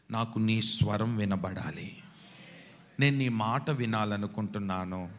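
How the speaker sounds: loud, slow pleading prayer; fervent prayer. Acted. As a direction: pray it out with longing. Preacher voicing devotee's prayer. (గట్టిగా, నెమ్మదిగా ప్రార్థన)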